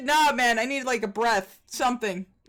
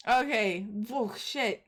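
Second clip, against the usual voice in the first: usual speaking voice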